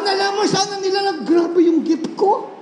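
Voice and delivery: laughing boast; laughing mock boast. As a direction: crow about your gift, laughing at yourself. Pastor mocks his pride.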